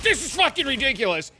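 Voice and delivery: high-pitched whiny protest; mock exasperation. Opponent protests the repeated bounce trick, mock exasperation. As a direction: squeal it like a frustrated sore loser.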